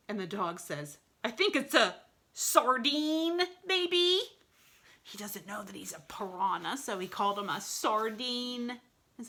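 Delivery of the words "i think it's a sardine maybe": higher, puzzled dog voice; confused dog. A narrator's reading. Dog guessing at the strange creature, high puzzled voice.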